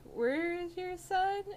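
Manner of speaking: high, slow incredulous ask; alarmed question. Retold or imitated. Cashier asking the old lady about her son, high and incredulous. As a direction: ask it in disbelief.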